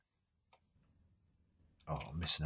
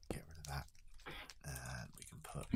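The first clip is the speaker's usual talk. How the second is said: whispered; 2 semitones lower, 15 dB quieter than usual. Real, not acted. Muttering to himself.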